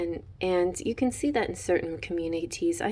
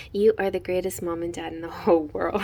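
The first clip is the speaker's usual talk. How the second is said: ordinary talk, nothing marked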